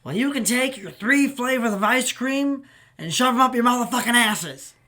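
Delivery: high, very loud imitation of an irate customer; retold angry scream. Homeless diner cursing out the staff over ice cream, retold angry scream. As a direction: yell it out as the furious man storming off. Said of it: "he screams this".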